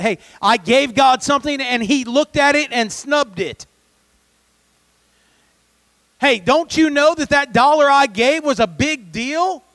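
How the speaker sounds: loud, high indignant protest, acted; mock furious. Preacher voicing Cain griping about his snubbed gift, mock furious. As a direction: play an offended giver complaining hotly. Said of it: "a little bit more furious over the fact".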